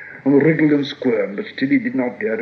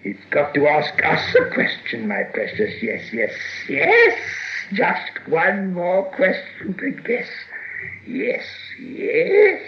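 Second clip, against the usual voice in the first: high, slow creature voice; wheedling Gollum; 7 semitones higher, slower than usual. A narrator's reading. Gollum asking Bilbo for one more question, high and wheedling. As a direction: draw it out, high and sibilant.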